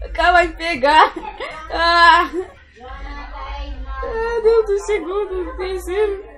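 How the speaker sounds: frightened, slow wailing cries; panicked crying out. Real, not acted. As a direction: panic as the enemy closes in. Enemy about to catch him.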